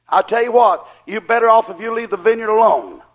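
usual speaking voice

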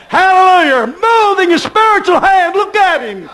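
high, loud hallelujah cry; joyful outburst. Real, not acted. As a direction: burst out with joy.

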